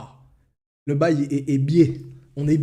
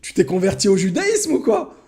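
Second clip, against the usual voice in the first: put-on much higher, louder teasing kid brother; mocking brother; 9 semitones higher, 5 dB louder than usual. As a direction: tease the big brother for fussing. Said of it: "Il se met à rire".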